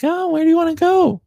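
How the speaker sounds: high-pitched imitation of the wife; wife's reply. Imitating his wife asking where they should hike, high-pitched.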